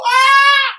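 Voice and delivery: a sudden high yelp of a man hitting the ground; high startled yelp, replaying the fall; reenacted fall scream. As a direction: let out a short, sharp scream as you go down. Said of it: "all you saw was me go".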